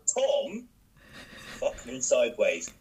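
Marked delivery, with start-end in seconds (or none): 0.1-0.7 high, puzzled "Paul?" as a question; confused mishearing. Retold or imitated. Cafe woman mishearing her name, high and puzzled. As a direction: mishear "Tor" as "Paul", baffled. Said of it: "she was like".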